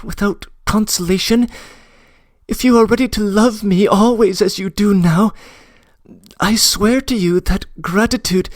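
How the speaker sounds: put-on a girl begging him to stay; young woman voice. Nastenka, breathless plea.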